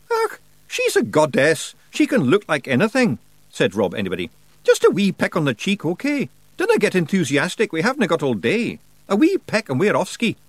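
high, loud Scottish brogue; cheeky Scots pixie; Scottish Feegle voice. Acted. Rob Anybody speaking.

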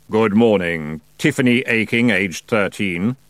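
solemn, droning, measured greeting; stately otherworldly monotone; Death's no-voice. Acted. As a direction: intone it as Death, without inflection. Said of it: "said Death in his no voice".

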